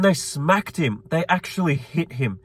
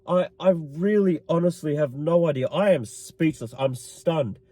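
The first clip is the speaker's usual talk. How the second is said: normal talking voice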